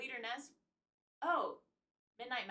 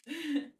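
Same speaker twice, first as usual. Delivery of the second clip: short loud laugh; quick high burst of laughter; 5 semitones higher, 9 dB louder than usual. Real, not acted. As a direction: let out one brief, louder laugh. Laughs while reading chat.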